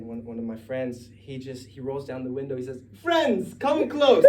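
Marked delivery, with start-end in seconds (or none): 3.0-4.3 high, loud mock-grand call; high pompous beckoning, hammed up; Peter voice. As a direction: ham up a pompous friend. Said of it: "that's Peter, right?".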